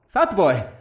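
fat boy with fat leaned on, mock hurt; mock offense. Dragon echoing his rider, mock hurt.

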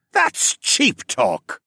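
slow, loud mock yell. Miner yelling at Alex, slow loud jeer. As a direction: spit it out slowly at the stranger. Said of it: "the man yelled".

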